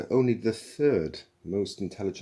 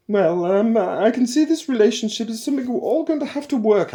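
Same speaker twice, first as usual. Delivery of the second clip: much higher motherly voice, louder rather than quiet; a wounded motherly concession; matriarchal computer; 12 dB louder than usual. A narrator's reading. Computer conceding to Zaphod, high motherly voice. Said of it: "Eddie said quietly".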